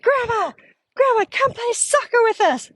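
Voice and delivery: put-on high excited child voice calling grandma; high sing-song kids' plea; kids calling. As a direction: play the grandkids begging grandma to come play. Kids calling grandma in retold story.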